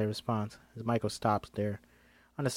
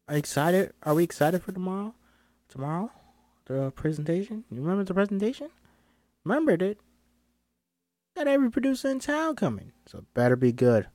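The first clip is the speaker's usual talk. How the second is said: put-on salty old-school agent, pitched up; Rosa Stevens; 6 semitones higher than usual. Agent pressing John about tomorrow's presentation, salty and high. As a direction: press with rising, higher questions.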